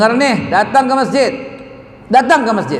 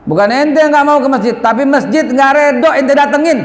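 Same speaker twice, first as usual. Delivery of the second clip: higher-pitched, emphatic scolding; stern retold rebuke; 8 semitones higher, 3 dB louder than usual. Preacher tells a young man off about the mosque, stern rebuke. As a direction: lay down the hard truth, voice raised in pitch.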